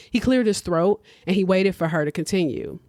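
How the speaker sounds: ordinary delivery, nothing marked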